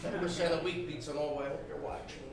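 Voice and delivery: ordinary talk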